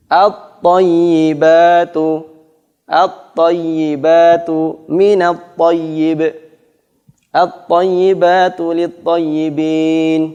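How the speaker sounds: at-thayyibatu drawn out slowly, lam merged into tha; slow Quranic demo of the merged lam; slow recitation demo. Shown as a demonstration. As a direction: recite each Arabic word slowly and clearly. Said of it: "Quran recitation example".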